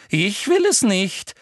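high, loud, slow and strained; shrill Umbridge. A narrator's reading. Umbridge claiming reluctance before torturing Harry, shrill. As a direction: protest too much, high and tight. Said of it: "sagte Umbridge".

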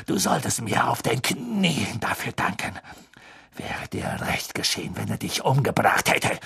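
hushed, whispery venom; quiet, breath-heavy contempt; seething taunt. A narrator's reading. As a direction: drop to a half-whisper full of spite.

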